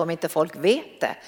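normal talking voice

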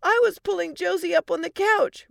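put-on high, loud little-kid voice; child explaining. Four-year-old explaining the accident to parents, high and upset. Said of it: "Ricky explained".

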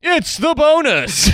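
very high, slow drawn-out announcer; bonus voice. Acted. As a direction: stretch it out in a silly high bonus voice. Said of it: "Stop doing the bonus voice".

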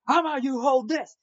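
snapping at the man; mock angry retort. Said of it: "reaches boiling point and says".

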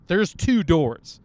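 two slow and loud, falling; stress on two. Acted. Telling viewers how far the toilet is, stress on two. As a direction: spell it out: there's TWO doors. Counting doors for emphasis.

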